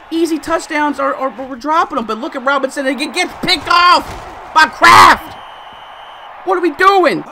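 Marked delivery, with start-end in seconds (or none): 2.9-7.2 higher, louder disbelieving shout; frustrated yell. Real, not acted. Gamer reacting to a thrown pick, frustrated yell.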